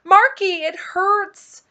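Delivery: high, loud shriek of pain; pained, high-pitched cry for help. A narrator's reading. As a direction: scream it out in agony. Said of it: "He shrieked and said".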